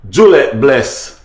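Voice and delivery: ordinary talk, nothing marked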